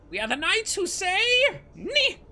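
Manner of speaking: very high, loud Monty Python knight; knights who say ni. Retold or imitated. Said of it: "kind of voice".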